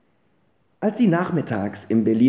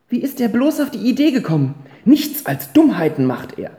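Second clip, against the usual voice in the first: much higher, faster fretting; exasperated mother; 10 semitones higher, 5 dB louder than usual. Acted. Mother to herself about her son, high and fast. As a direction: fret over the boy's foolishness.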